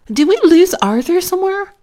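a high, loud and fast yell. A narrator's reading. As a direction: blurt it out, shouting to Mom. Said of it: "D W yelled".

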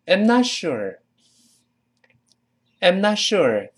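"I'm not sure" with high, stressed "not sure" and a fall. Shown as a demonstration. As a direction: weaken "I'm" to a short 'm, lean on "not sure". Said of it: "not sure这重读的".

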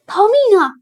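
high, loud cry to flee; panicked shout. Acted. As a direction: yell like a frightened crowd. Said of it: "他们叫道". (惊恐地高声喊叫)

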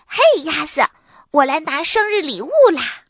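high, loud and gleeful; 兴高采烈. A narrator's reading. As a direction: burst in elated. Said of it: "马菲兴高采烈地说". (兴高采烈地高声喊)

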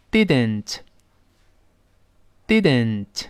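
didn't read slowly, each sound held apart. Shown as a demonstration. Teacher reading didn't slowly for students. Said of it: "先慢慢读".

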